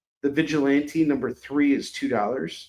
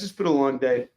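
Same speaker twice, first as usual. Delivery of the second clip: usual speaking voice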